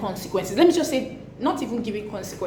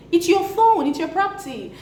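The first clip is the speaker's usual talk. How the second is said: indignant emphasis; 8 semitones higher than usual. Real, not acted. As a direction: insist firmly that it is your phone. Indignant point to parents.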